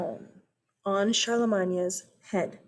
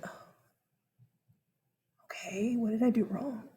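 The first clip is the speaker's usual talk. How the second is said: nervous whisper; 8 dB quieter than usual. Retold or imitated. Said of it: "Charlemagne is like".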